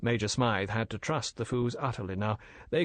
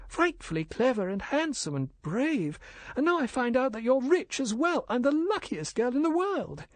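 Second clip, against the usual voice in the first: put-on high, gushing young woman's voice; breathless admiration pitched high; young wife, adoring; 13 semitones higher than usual. Wife adoring her rich husband, high and gushing.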